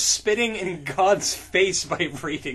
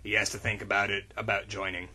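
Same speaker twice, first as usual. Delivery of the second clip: lower, fast, softer cowboy voice; lightly voiced gruff ranger; 10 semitones lower, 6 dB quieter, faster than usual. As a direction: drop low and rush it as Cordell. Cordell voiced low.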